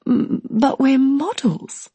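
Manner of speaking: high, slow airy model voice; dim model voice. Retold or imitated. The brunette blurts her excuse, high and slow. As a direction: stretch it out high and vacant. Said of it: "She says".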